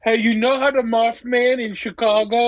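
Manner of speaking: a light tease of the Mothman guy; imitating Russo. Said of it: "What the fuck does he say?".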